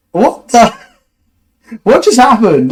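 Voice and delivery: as if the free gifts make no sense; stunned disbelief. Retold or imitated. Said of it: "I'm just like".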